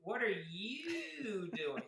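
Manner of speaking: slow, stretched Southern drawl on every vowel. Retold or imitated. Imitating Southerners with a long slow Southern drawl. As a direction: draw out "you" like a Texan. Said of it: "so many U's on".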